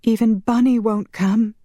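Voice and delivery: high, upset woman's voice; distressed cry. A narrator's reading. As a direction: cry it out in despair, pitched high. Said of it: "Marielle cried".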